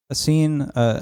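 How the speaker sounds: ordinary delivery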